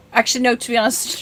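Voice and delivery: ordinary delivery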